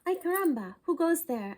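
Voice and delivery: loud startled call. Acted. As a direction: call out into the dust. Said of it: "asked Skippy John Jones".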